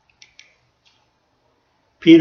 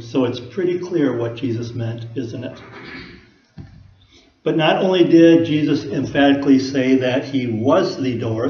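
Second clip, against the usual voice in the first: ordinary delivery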